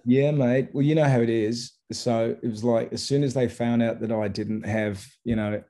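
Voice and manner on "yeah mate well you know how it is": Australian accent. Shown as a demonstration. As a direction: lay on the Australian accent for the joke. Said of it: "put on an Aussie accent".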